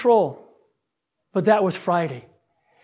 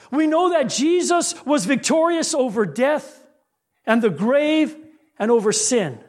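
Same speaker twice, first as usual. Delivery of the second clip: preaching. Acted. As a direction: urge the church to boldness. Closing exhortation.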